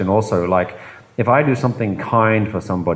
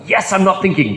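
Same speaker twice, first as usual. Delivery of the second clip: high, pleased mock voice; mock proud meditator; 10 semitones higher than usual. Mocking the proud meditator, high pleased chirp.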